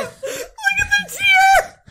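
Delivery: high, slow laughing squeal; laughing shriek. Real, not acted. Laughing at crying friend.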